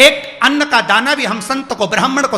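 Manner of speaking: high, loud preaching build; full-force preaching. Acted. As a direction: preach it out, climbing with each name. Preaching list of recipients. (ऊँची आवाज़ में जोशीला प्रवचन)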